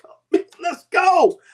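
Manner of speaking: a husband chasing his wife around, excited and high; pumped up. Retold or imitated. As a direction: hype yourself up like a teenager again. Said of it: "I'm like".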